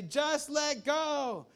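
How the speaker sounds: high, slow, drawn-out call; mysterious calling voice. Acted. Unseen voice to the panicking climber, high slow call. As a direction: stretch it out like someone calling through fog. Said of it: "you hear this voice say".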